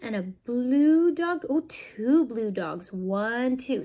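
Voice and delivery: slow, lilting picture-book read; reading to children. Acted. Parent reading a counting book to a toddler, slow and sing-song. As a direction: draw the little listener into each page.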